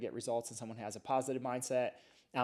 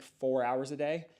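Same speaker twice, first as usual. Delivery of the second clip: usual speaking voice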